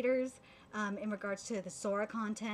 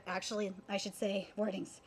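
ordinary talk

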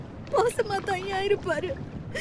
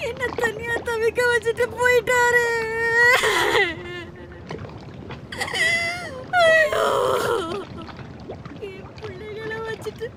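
wailing grief; 4 semitones higher than usual. Acted. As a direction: wail over the dead husband. Widow learns husband died.

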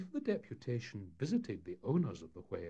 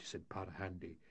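plain reading voice

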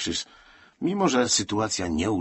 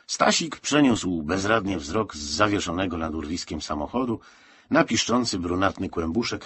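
usual speaking voice

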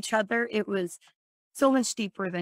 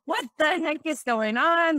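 pitched way up and louder, baffled; confused alarm; 7 semitones higher, 4 dB louder than usual. Retold or imitated. Chasing the stranger on her bike, startled and baffled. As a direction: shout it out, bewildered mid-chase. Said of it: "and I'm like".

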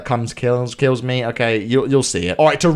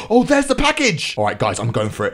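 ordinary talk

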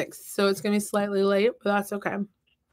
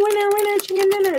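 excited catchphrase; 11 semitones higher, faster than usual. Real, not acted. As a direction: announce the win like a game host. Catchphrase after guessing right.

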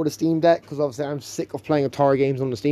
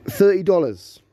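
surprised; 5 semitones higher, 4 dB louder, slower than usual. Real, not acted. Shocked at the price again.

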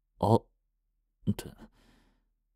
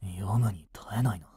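hushed disgusted whisper; 3 semitones lower, 7 dB quieter than usual. Acted. Boy reading graffiti on his friend's desk, whispered in disgust. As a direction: whisper it, sickened by what he reads.

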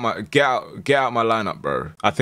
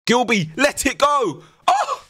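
excited urging; slower than usual. Real, not acted. Urging shot, then reacting.

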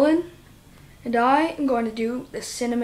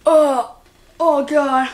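pained outcry; 3 semitones higher, 7 dB louder, slower than usual. Real, not acted. Still suffering from cinnamon.

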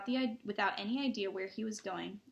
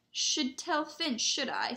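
slow, lightly put-on saintly tone; 6 semitones higher, 3 dB louder, slower than usual. A narrator's reading. Peeves threatening to tell on students, mock saintly. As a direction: play the innocent do-gooder, a little sing-song. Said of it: "said Peeves in a saintly voice".

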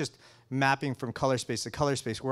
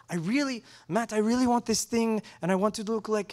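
higher, eager voice piling on requests; imitating Yvonne; 6 semitones higher than usual. Imitating the colorist asking Matt for a look, higher pitched. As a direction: do the colorist rattling off wishes.

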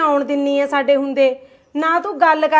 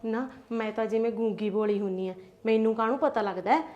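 much lower and softer, aggrieved grumble; sulky muttering; 5 semitones lower, 8 dB quieter than usual. Acted. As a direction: mutter your hurt while packing. Sulking, grumbling aside. (ਰੁੱਸ ਕੇ ਹੌਲੀ ਹੌਲੀ ਬੁੜਬੁੜ)

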